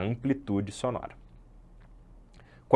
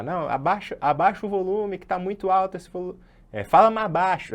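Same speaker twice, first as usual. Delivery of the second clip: higher, louder complaint; mock annoyed complaint; 9 semitones higher, 4 dB louder than usual. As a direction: complain that it's too loud. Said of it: "quando alguém fala". (reclamando que está alto demais)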